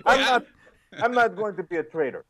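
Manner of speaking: lightly acted Russian character accent. Host mimicking the episode's Russians, mock Russian accent. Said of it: "same accent for all Russians in this episode".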